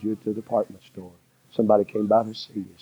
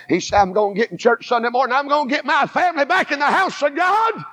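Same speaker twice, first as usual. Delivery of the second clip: full-force preaching; 4 semitones higher than usual. Acted. Preacher telling the church about the roadside prayer, in full-force preaching. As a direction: preach it with rising fire.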